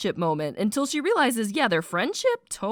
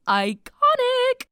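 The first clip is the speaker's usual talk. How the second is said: high, loud, slow drawn-out exclaim; excited; 4 dB louder, slower than usual. Real, not acted. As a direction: squeal it with delight. Excited reaction.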